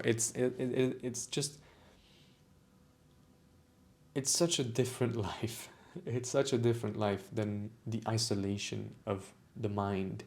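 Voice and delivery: moved to the edge of tears, holding it together; real emotion. Real, not acted. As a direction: voice softening and catching, a small laugh through it. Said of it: "it's making me emotional".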